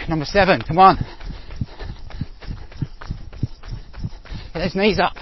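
loud, strained push through the rep; motivational shouting. Real, not acted. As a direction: yell yourself up the hill. Pushing through hill sprint reps.

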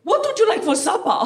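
put-on a high, lightly posh mother asking a toddler; hammed-up posh mum cooing at her child; posh woman voice. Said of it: "She leans over to a two-year-old and goes".

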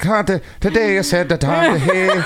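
high, hammy leprechaun brogue; overdone cereal-ad Irish accent; cartoon Irish accent. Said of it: "an Irish accent that you learned from a Lucky Charms commercial".

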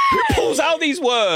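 very high, squealing disbelief; incredulous outburst. Real, not acted. Co-host astonished at her vocabulary, high incredulous cry. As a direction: cry out in amused amazement.

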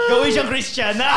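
loud laughing mock protest; laughing shout. Mock outrage at a name.

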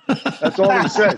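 said through a laugh, slowly. Real, not acted. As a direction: chuckle through the punchline. Retelling funny [name] call.

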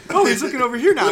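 squeaky sing-song tease. Retold or imitated. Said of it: "Locay is just like".

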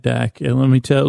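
ordinary delivery

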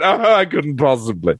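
lightly acted false modesty; mock coy actress. Actress asked to do the line for interviewers, mock-coy refusal. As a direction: feign reluctance like a coy performer. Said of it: "that great actress thing of saying".

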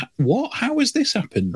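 high incredulous what; mock disbelief. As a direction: squeak out the disbelief. Incredulous at huge follower count.

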